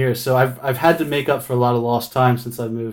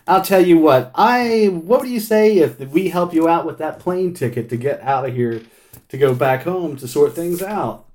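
friendly official; 5 semitones higher than usual. Retold or imitated. Phone official offering him a plane ticket, chummy and high. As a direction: sell the deal, pitched up and chummy. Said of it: "He's like".

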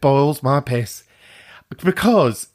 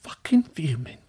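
whispered fury; 4 dB quieter than usual. Pretend. Mock whisper after caller's message.

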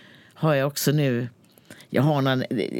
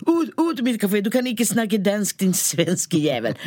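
higher, angry mock Danish barista; angry Danish barista, kicking him out; furious; 6 semitones higher than usual. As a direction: throw the customer out in a rage. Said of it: "hon blir så skitförbannad".

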